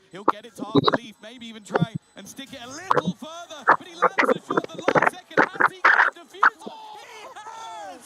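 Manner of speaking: rising to an excited high shout; esports caster. Real, not acted. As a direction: build the hype to a clutch win. Live play-by-play of a clutch.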